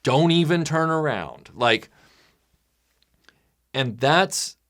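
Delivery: ordinary talk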